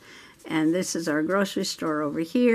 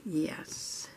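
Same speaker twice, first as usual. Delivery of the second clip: whisper; 2 semitones lower, 13 dB quieter than usual. Real, not acted. Whispered choice while coloring.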